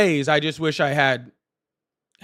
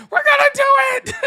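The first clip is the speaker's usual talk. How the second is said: squealed way up high and a bit louder, all hyped; excited squeal; 4 dB louder than usual. Retold or imitated. Streamer reliving her excitement over rare DJ glasses, squealing high. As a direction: replay the thrill of spotting the four DJ glasses. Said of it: "And I saw four and I was like".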